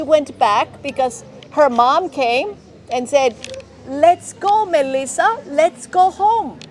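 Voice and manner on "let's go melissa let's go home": high, sing-out mum voice; higher-pitched storytelling mother; mother calling her child. Acted. Mom calls child in story.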